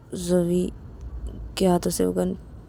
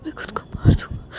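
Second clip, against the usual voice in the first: 1 whispered threat; 4 dB quieter, faster than usual. Acted.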